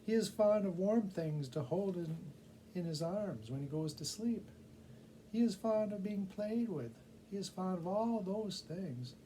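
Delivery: excited tiny bat bringing gossip; high squeaky bat. A narrator's reading. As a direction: play the little bat, eager with news. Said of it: "said the bat".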